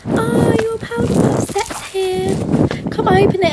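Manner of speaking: high, slow sing-song voice for the app message; high teasing app voice; mock notification voice. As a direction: read the notification like a cheery announcer. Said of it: "my little notification saying".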